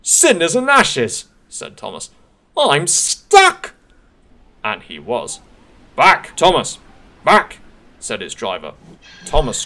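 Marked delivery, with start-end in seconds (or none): 0.0-1.2 high, loud, slow cry of dismay; dismayed exclamation. Acted. Thomas crying out as he hits the snow, high and loud. 2.6-3.7 high, loud wail of dismay; dismayed engine. Acted. Thomas wailing that he is stuck, high and loud. 6.0-7.6 loud, high urgent shout; urgent call. Acted. Driver shouting Thomas back, loud.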